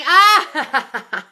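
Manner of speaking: high loud delighted squeal with a laugh; excited shriek. Real, not acted. Thrilled yelp at a viewer's good news.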